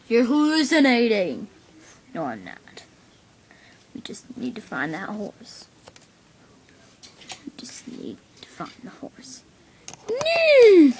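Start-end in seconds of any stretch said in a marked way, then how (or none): 2.1-9.4 soft hushed whisper. Acted. To his friend while stalking the horse, a whisper. 10.1-10.9 high whinnying neigh; horse neigh. Acted. Playing the horse running away, a neigh.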